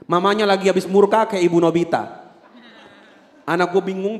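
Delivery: ordinary talk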